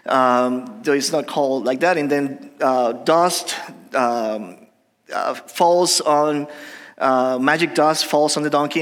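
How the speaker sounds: normal talking voice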